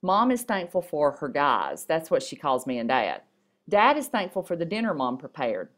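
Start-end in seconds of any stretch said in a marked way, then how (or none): none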